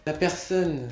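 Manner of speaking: usual speaking voice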